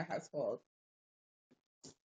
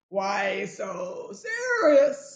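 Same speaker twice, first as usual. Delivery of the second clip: mock Joker; 10 dB louder, much slower than usual. Joker line quoted after mood remark.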